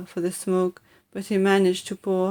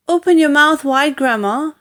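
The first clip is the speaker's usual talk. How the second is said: put-on coaxing boy; 7 semitones higher, 5 dB louder than usual. George coaxing Grandma.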